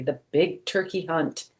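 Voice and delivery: usual speaking voice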